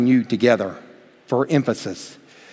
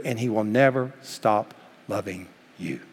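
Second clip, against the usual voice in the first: soft assurance; 5 semitones lower, 7 dB quieter than usual. Real, not acted. Intimate promise to listeners.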